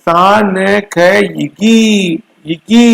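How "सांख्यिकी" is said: सांख्यिकी said very slowly with a rising end; teacher drilling सांख्यिकी very slowly; sounding out a word. Shown as a demonstration. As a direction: draw सांख्यिकी out slowly, then lift the end. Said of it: "सही बोलोगे तब".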